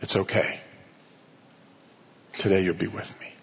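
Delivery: low, hushed comforting voice; gentle reassurance. Retold or imitated. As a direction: reassure softly and low, with pauses. Voicing Jesus reassuring someone.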